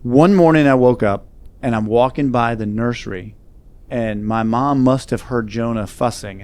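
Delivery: ordinary talk, nothing marked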